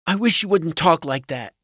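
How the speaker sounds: high-pitched wounded wife; upset wife. A narrator's reading. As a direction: plead in a high, hurt wife voice. Upset wife protests husband's cursing.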